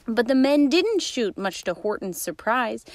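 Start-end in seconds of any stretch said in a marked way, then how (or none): none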